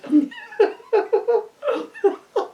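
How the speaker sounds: helpless high giggle; high squealing laugh. Real, not acted. Laughing at own story.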